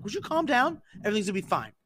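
high-pitched, retold "relax" voice; exasperated reassurance. Prankster telling a panicking friend to calm down, exasperated. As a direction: start very high, then ease down.

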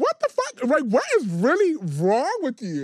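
high, loud incredulous cry; demanding what is wrong with him; exasperated disbelief. Retold or imitated. Speaker retelling confronting her friend, high and loud.

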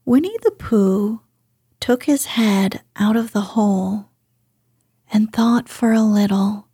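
plain reading voice

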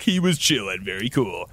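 ordinary talk

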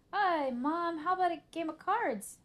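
high, loud, forced cheeriness; over-eager. Acted. Arthur keeping Mom from the computer, over-eager. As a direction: suggest it too eagerly to distract. Guilty child distracting mother.

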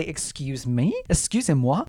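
usual speaking voice

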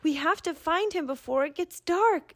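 fighting tears but pushing on; holding back tears. Acted. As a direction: Mira near tears, urging the others. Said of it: "swallowing back tears".

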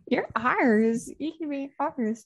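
lightly voiced creepy alien; a hint of a menacing alien. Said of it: "I like your alien voice".

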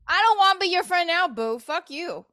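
hurt and angry retort to the guy; angry outburst. Real, not acted. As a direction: spit it back at him.

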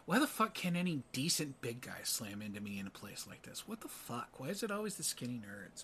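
grumbling aside. Acted. Heroine complains to herself as he leaves, grumbling aside. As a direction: grumble it to yourself.

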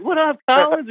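high-pitched mock hail; imitated buddy greeting. Podcast hosts hailing their guest at the show, high mock greeting. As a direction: call to a pal in hammed-up delight.

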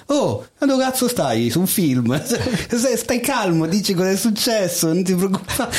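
high-pitched mock scolding; hospital girl's rebuke. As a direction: imitate a nurse cutting down an overexcited rookie. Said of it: "una delle ragazze dell'ospedale lo incrocia".

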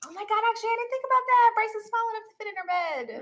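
high-pitched, excited squeal of an idea; sudden excited realisation. Real, not acted. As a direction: let the pitch leap with delighted realisation. Sudden funny realisation about the dog bed.

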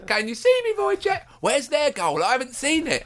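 high preening camp voice; shrill camp pantomime dame. Retold or imitated. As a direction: call out like a vain panto star. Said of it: "that's how he speaks".

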